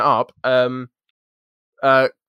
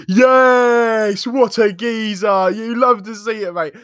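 much higher and louder, a delighted cheer; cheering sixth formers; 10 semitones higher, 6 dB louder, slower than usual. Retold or imitated. Older students cheer a boy who boasts of his insult, delighted roar. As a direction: hail the new kid like a hero. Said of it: "everyone was like".

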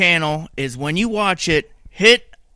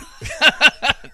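burst of laughter; 10 semitones higher, 3 dB louder than usual. Real, not acted. Host laughs at own joke.

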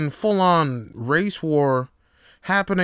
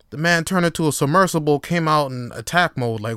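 ordinary talk

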